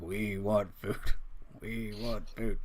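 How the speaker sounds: mild sulky chant of a hungry half-orc; whiny hungry brother. Acted. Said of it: "the corner going, like".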